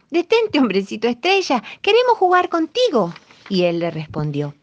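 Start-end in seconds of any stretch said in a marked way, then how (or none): 0.1-3.1 high loud group call. A narrator's reading. Fishermen call after the star man, high loud shout. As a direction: shout together for him to stop. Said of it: "le gritaron".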